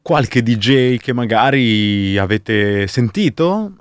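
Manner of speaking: ordinary talk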